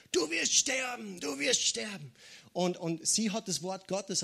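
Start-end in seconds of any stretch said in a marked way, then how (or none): 0.1-2.1 mock scream. Inner voice tormenting a sick woman, mock scream. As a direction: imitate a screaming voice in the head, pitched up. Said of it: "die geschrien hat".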